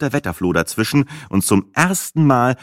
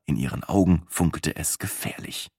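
ordinary delivery

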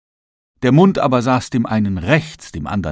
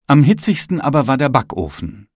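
plain reading voice

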